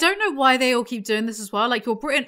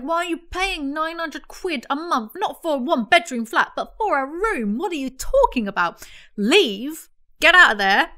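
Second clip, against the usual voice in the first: higher, incredulous mock scolding; exasperated rant; 5 semitones higher than usual. Telling friends who still live in London to leave, exasperated.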